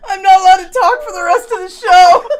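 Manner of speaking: high, loud, fast through laughter; real laughing, rushed and high; laughing outburst. Real, not acted. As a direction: blurt it out while cracking up. Recovering from helpless laughing fit.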